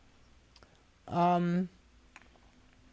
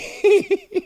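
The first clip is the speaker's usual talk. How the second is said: high burst of laughter; high delighted laugh; high cackle; 12 semitones higher, 5 dB louder than usual. Real, not acted. Laughing at a sign.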